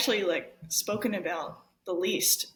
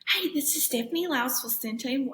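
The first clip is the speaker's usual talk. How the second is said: phone greeting voice; 5 semitones higher than usual. Retold or imitated. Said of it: "I'd be like".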